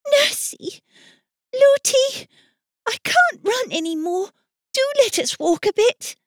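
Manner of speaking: put-on high, breathless child's voice; pleading little girl. Princess begs her nurse to stop running, high and pleading. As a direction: beg like a worn-out small girl. Tired child begging to slow down.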